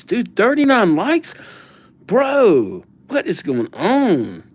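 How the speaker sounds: high, amazed burst at the likes; a genuinely stunned "no way"; real surprise at the rising likes; surprised. Real, not acted. Surprised at the like count.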